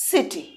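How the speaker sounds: সিটি with an s sound for the c; correct pronunciation. Shown as a demonstration. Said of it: "আমরা কি বলি? এটাকে".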